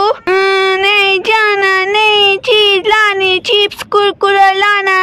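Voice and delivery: put-on drawn-out, slow childish whine; whining little boy. Small son refuses to leave the cow, slow sulky whine. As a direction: stretch every word like a sulking kid.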